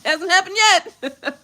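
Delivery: high, puzzled denial; retold confusion. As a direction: reenact baffled disbelief with a laugh. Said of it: "totally confused".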